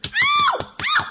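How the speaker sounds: very high loud yelp; piercing ow; sharp cry of pain. Acted. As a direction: yelp as if smacked. Spanked, she cries out.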